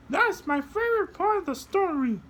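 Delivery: soar up into a tiny high voice; high puppet voice. Acted. Puppet shows kids how to fly, very high voice.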